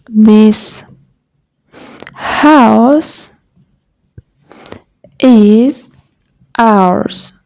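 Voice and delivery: word by word with long gaps; slow dictation. Shown as a demonstration. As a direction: classroom dictation pace. Teacher dictating a translation.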